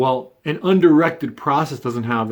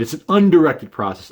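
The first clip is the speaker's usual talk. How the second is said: "undirected" punched higher and louder; emphatic undirected; 4 semitones higher, 3 dB louder than usual. Real, not acted. Speaker correcting the idea of pure randomness, emphatic "undirected". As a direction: hit "undirected" hard as the key word. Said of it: "an emphatic word here".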